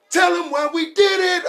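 high, loud shouted imitation; imitating shouted plea. Friend yells at the cop, high and loud. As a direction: yell it up high like a man begging. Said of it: "And he's like".

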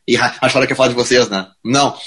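normal talking voice

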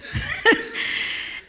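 a breathy high giggle; real airy giggle; high airy laugh. Real, not acted. Laughing at her own struggle.

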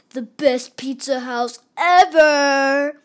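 loud, slow stretched jingle line; ad tagline. Acted. Sponsor plug at the end, loud slow tagline. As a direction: belt the slogan.

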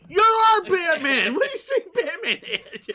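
high, loud mock outrage; incredulous shout. Mocking Batman's slip to Alfred, high incredulous shout. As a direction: yell it in comic disbelief.

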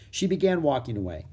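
ordinary delivery, nothing marked

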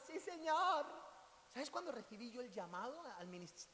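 ordinary talk, nothing marked

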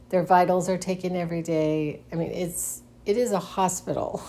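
ordinary talk

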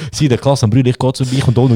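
usual speaking voice

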